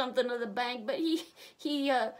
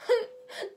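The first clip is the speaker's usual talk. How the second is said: a loud, high wailing sob; high sobbing wail; 11 semitones higher, 4 dB louder than usual. A narrator's reading. Banker sobbing to the sheriff, high wail. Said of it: "Elmer Stubbs sobs".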